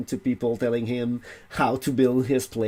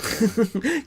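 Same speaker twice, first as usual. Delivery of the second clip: laugh; 10 semitones higher than usual. Real, not acted. Laughing at unfinished story.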